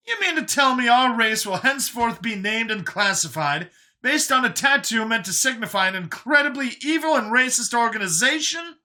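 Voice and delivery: high, loud, indignant character voice; incredulous Brian. Acted. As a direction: pitch it way up, loud with disbelief.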